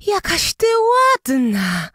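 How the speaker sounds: wysoko i głośno, z zachwytem; zawołaj z zachwytem, powoli; wołają z podziwem. A narrator's reading. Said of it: "wołały dziewczyny". (dziewczyny wołają do Anny z podziwem)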